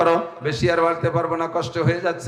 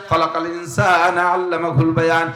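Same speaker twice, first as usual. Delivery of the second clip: slow recitation; slower than usual. Shown as a demonstration. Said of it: "same verse repeated".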